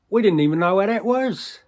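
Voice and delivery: loud, high-pitched disbelief; incredulous exclamation. Acted. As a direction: call it out high and loud, amazed. Bewildered climax of the recruitment tale.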